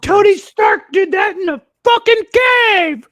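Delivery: full-volume, high, drawn-out yell; furious mock shout. Ranting about doing it cheap in a cave, furious shout. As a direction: bellow it slowly, word by word, way up high.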